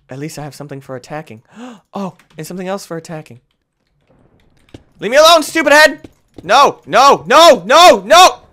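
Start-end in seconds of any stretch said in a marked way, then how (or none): 5.0-8.4 loud high panicked shouting; panicked yell. Real, not acted. Panicked yelling in game.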